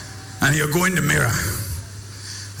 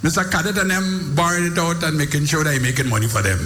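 full-force preaching; 9 semitones higher, faster than usual. Acted. Preacher rousing the congregation about confidence, shouted preaching.

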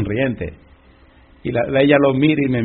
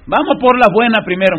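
higher, loud "vamos por la buena"; rally the boys lightly; a touch of a bold leader; 8 semitones higher, 6 dB louder than usual. Acted. Preacher voicing Moses' plan. (un toque de líder animando)

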